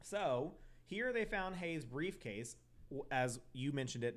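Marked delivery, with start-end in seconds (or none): none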